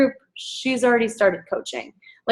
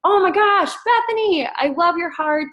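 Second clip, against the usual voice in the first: much higher, delighted mock message; gushing praise; 8 semitones higher than usual. Coach messaging Bethany with high, gushing delight. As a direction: read your own message back with high delight. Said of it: "So I messaged her and I'm like".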